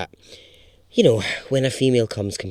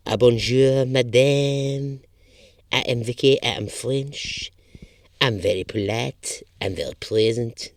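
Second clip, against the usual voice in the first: put-on French accent. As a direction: put on a mock French lady. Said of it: "she has a French accent".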